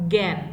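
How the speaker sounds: জ্ঞান said as গ্যাঁন, with a g sound; pronunciation demo. Shown as a demonstration. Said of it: "গ দিয়ে উচ্চারণ করতে হচ্ছে". (জ্ঞান গ দিয়ে উচ্চারণ)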